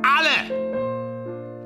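high ringing cry of triumph; exultant high outburst; rousing exclamation. Acted. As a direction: proclaim it high and drawn out to the whole room. Drunken speech to the ensemble.

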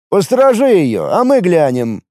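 instructing a subordinate; giving orders. A narrator's reading. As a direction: order your partner about firmly. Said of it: "распорядился он".

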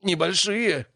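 high indignant outburst; outraged disbelief at the word. A narrator's reading. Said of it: "возмутился Леон".

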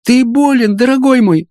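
put-on very high-pitched girlish exclamation; alarmed young fraulein. A narrator's reading. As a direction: cry out in concern for the beloved. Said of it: "воскликнула фройлен".

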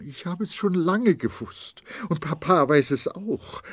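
high, choked, stumbling words; wife stammers her confession, suppressing tears; stammering, holding back sobs. A narrator's reading. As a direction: fight the sobs as you speak. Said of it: "stammelte sie und versuchte, ihr Schluchzen zu unterdrücken".

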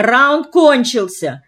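high, drawn-out loud call; crying out. A narrator's reading. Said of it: "закричала она". (протяжный громкий выкрик)

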